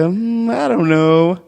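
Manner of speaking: awkward dirty talk. Retold or imitated. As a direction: try to talk dirty and fail, trailing off. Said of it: "I just sound like a".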